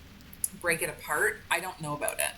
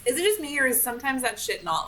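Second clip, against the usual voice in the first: higher and louder, incredulous; exasperated; 9 semitones higher, 5 dB louder than usual. Real, not acted. Gamer exasperated that the puzzle is not intuitive. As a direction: throw up your hands at the game.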